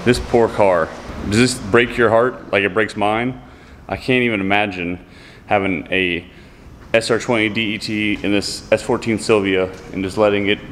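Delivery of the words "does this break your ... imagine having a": ordinary talk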